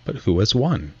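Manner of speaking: ordinary delivery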